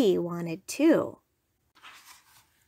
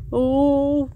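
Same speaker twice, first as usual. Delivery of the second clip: louder, higher, put-on walrus voice; worried walrus; 4 semitones higher, 5 dB louder than usual. A narrator's reading. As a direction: fret aloud as a walrus. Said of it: "worried Walrus".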